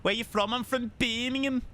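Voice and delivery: lightly put-on Birmingham accent; Brummie accent. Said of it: "a freaking Brummie accent".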